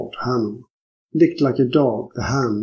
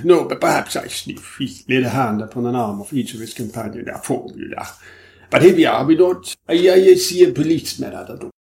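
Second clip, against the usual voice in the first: French detective Hanaud; 3 dB louder than usual. Acted. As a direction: play the sly foreign detective springing a joke. Hanaud's voice again.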